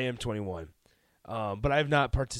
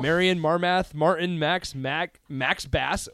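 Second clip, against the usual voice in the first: roll call; 3 semitones higher, 4 dB louder, much slower than usual. Real, not acted. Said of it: "Sounds like you're doing roll call".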